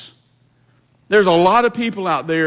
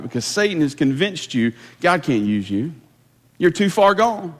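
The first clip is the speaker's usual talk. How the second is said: ordinary delivery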